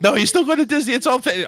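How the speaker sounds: high, loud acted reassurance; hasty reassurance. Host playing someone reassuring a kid about Disney, urgently.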